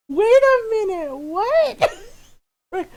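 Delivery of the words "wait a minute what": loud, high-pitched disbelief; incredulous. Retold or imitated. Adult child reacting to mom's odd word, loud incredulous outburst. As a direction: stop everything in loud, high astonishment.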